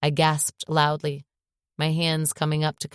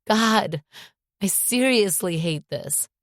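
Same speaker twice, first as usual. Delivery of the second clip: crying; 5 semitones higher, slower than usual. Acted. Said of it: "still crying".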